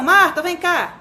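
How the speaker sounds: high-pitched call to the wife. Acted. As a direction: call your wife over for help. Father calls mother over. (chamando a esposa, tom agudo)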